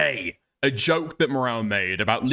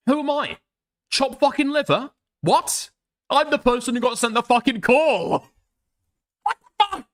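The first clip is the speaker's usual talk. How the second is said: high, loud disbelieving cries of what; stunned and indignant, voice jumping up; shocked outburst; 9 semitones higher, 3 dB louder than usual. Real, not acted.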